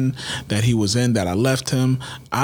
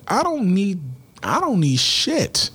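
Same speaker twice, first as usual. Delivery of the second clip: retold defiance; 7 semitones higher than usual. Retold angry reply to wife.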